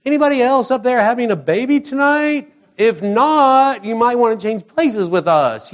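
high, loud, hammed-up call to a whole house; Joseph calling out. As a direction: holler upstairs as an exasperated Joseph. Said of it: "if I had been Joseph".

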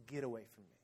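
very soft, low voice; guilt-laden plea. Retold or imitated. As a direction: push him away quietly, weighed down by guilt. Peter, full of guilt, to Jesus.

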